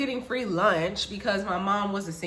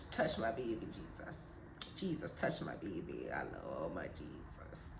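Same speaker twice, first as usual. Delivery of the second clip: hushed, fading plea to Jesus, much softer; a mother's whispered prayer fading to nothing; praying mom; 9 semitones lower, 11 dB quieter than usual. Retold or imitated. Mother praying over her daughter, hushed and fading. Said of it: "mom praying over daughter".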